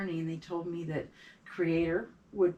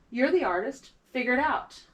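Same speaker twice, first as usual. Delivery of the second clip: higher, louder retold insistence; firm retold push; 7 semitones higher, 7 dB louder than usual. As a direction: imitate an elder insisting, emphatic and raised. Elder firmly pushing her, retold.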